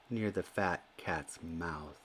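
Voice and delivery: ordinary delivery, nothing marked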